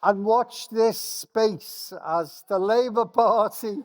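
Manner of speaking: excited. Real, not acted.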